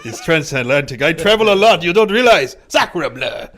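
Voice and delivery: mock Sacre bleu, overacted French; a lightly faked French accent, half laughing; Napoleon voice. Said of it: "What accent is that, Napoleon?".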